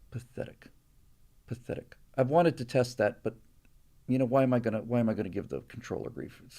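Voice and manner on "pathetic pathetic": quiet scornful mutter. Real, not acted. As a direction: mutter it under your breath.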